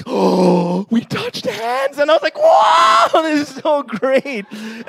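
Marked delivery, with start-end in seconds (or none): 0.0-2.0 awed gasp. Retold or imitated. 2.4-4.5 much higher and louder burst, then slower; mock thrill. Boy gushes to himself about holding hands, squealing.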